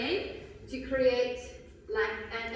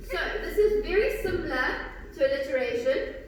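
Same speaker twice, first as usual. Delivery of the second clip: ordinary delivery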